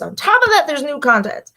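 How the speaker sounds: high, loud, breathless excitement; excited burst. Real, not acted. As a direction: shout it out, overwhelmed with excitement. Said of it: "I have to catch my breath".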